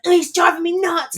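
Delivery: high, loud mock exasperation; imitating herself fed up; exasperated quote. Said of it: "I used to, like, literally be like".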